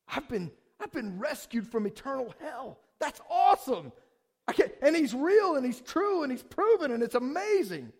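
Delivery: high, loud, bursting with amazement; can't contain the good news, pitch soaring; thrilled outburst. Acted. Preacher acts a believer rescued from hell, thrilled.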